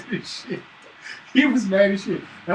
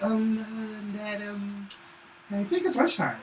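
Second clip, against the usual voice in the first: timid little son; 2 semitones higher, 7 dB quieter, slower than usual. Retold or imitated. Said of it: "So he'll let me know".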